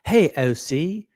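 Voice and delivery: put-on a light, slow British accent on hey, O C; mock British accent. Imitating a stranger greeting him, a light mock British accent. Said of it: "he did it in a British accent".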